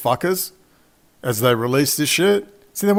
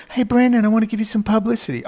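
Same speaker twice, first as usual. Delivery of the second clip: much higher, fast, eager host voice; Tucker Carlson; 10 semitones higher than usual. Retold or imitated. Host phoning the vlogger with an offer, much higher and eager. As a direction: chirp it like a keen TV host pitching. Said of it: "Tucker's gonna call".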